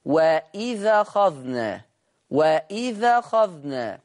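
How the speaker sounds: وإذا أخذنا slow, the ذ carrying the vowel, no hamza; transfer of hamza vowel. Shown as a demonstration. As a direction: glide وإذا أخذنا as one. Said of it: "هينقل حركة الهمز للذال".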